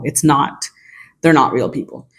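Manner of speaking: ordinary talk, nothing marked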